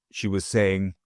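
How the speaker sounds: ordinary delivery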